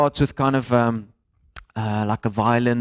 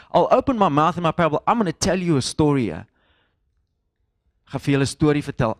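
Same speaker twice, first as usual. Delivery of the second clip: modern-day paraphrase; 3 semitones higher, faster than usual. Acted. Preacher putting the psalm into today's language, quick and casual. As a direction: toss it off briskly in everyday language. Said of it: "what is he saying in two thousand sixteen language".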